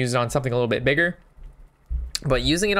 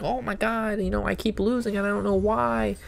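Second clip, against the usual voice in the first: higher, whining complainer; whiny player; 7 semitones higher than usual. Retold or imitated. Streamer imitating a whining player, higher. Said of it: "they complain, like".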